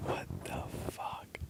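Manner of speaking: slow whispered disbelief; hushed what the fuck; stunned whisper. Real, not acted. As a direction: whisper it in shock. Startled by cat running.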